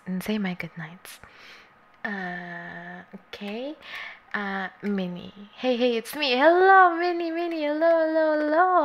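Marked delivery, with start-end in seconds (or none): 0.1-1.2 whispered. Real, not acted. Promising to say goodnight. 6.4-8.8 excited greeting. Real, not acted. Greeting a viewer.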